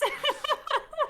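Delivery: squealing laugh; high burst of laughter. Real, not acted. Can't stop laughing.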